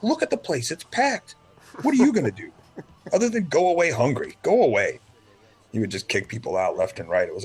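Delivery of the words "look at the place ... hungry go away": high, mocking dismissal; scoffing owner. Retelling the owner shooing off complainers, high scoffing voice. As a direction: laugh them off high and smug. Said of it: "He's like".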